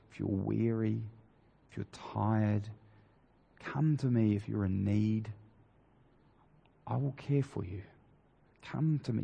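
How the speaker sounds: soft, gentle and unhurried, like calling someone weary to rest; tender invitation. Acted. Preacher voicing Jesus to the weary congregation, softly tender.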